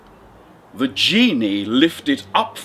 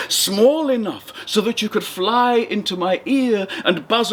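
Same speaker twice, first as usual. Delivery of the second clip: higher, nervous fisherman with a laugh; a frightened little man stalling for time, higher; 8 semitones higher than usual. Acted. Fisherman stalling the genie, nervous and higher-pitched.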